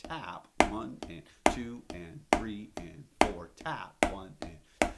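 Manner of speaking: slow, steady counting over the drum; calling the counts over the drumming; slow counting while playing. Shown as a demonstration. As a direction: count it out slowly and loud enough to hear over the drums. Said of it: "we're gonna go one and two".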